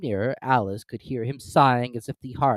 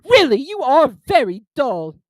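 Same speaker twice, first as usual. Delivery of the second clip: scornful turtle; 14 dB louder, slower than usual. Acted. Mock Turtle scolding Alice.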